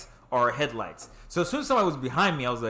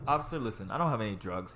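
low, softer, placating answer; retold politeness; 5 semitones lower, 7 dB quieter than usual. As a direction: calmly reason with the officer. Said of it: "I'm gonna be mad polite".

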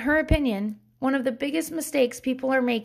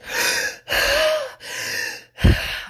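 exaggerated huffy gasps; imitated deep breaths. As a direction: huff like a cross toddler. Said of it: "he just goes".

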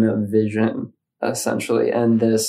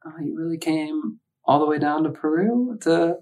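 inner voice; 6 semitones higher than usual. Retold or imitated. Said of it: "getting this thought strand coming through".

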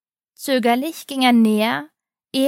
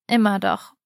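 gentle, lower reassurance; mild; 3 semitones lower than usual. A narrator's reading. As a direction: say it kindly, like a father would. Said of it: "sprach er milde".

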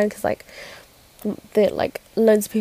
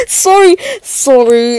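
loud, high apology out of laughter; flustered apology; 4 semitones higher, 6 dB louder, slower than usual. Real, not acted. Speaker apologising for naming him, flustered and laughing. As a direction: apologise while cracking up.

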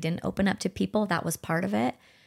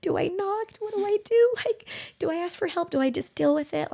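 much higher, strained, tearful questions; retold crying outside a friend's door; teary panic; 13 semitones higher than usual. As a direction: ask yourself through tears, lost. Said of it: "crying outside your door".

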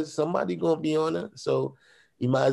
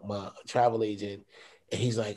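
normal talking voice

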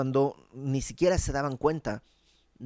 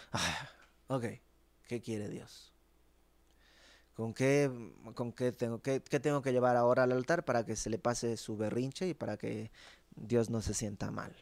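muttered mock annoyance, much softer; sarcastic grumble; 3 semitones lower, 4 dB quieter than usual. The people grumbling about God's wishes, in soft sarcasm. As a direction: grumble it off to the side, scoffing. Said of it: "En una actitud así".

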